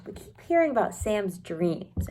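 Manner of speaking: ordinary delivery, nothing marked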